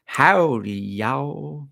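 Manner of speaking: high, exaggerated Texas drawl; terrible Texas accent. Imitating an actress's terrible accent, overdone Texas drawl. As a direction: ham up a bad Texas accent. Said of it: "she did this Texas accent".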